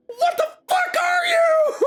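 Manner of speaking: drawn-out, very high full scream. Acted. Desiree with knife drawn confronts the visitor, screaming. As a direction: scream it slowly at top volume. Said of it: "She, she's screaming".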